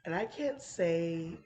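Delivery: ordinary talk, nothing marked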